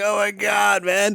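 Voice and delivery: high, awed voices of the class; impressed students. Retold or imitated. Students react to the piercing tone in high awe. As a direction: gasp at the grating tone. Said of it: "they're like".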